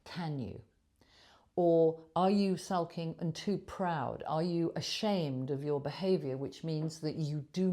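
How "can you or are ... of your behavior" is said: ordinary talk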